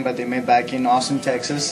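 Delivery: ordinary talk, nothing marked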